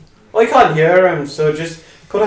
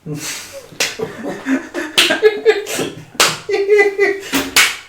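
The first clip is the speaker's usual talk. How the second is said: high breathy laugh. Real, not acted. Long high airy laugh.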